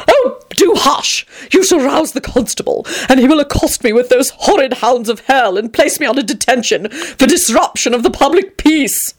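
fussy dandy. A narrator's reading. Tony hushes excited Teddy.

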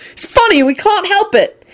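high, protesting cry; angry yell. A narrator's reading. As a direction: argue back in a high angry voice. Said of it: "Ron yelled angrily".

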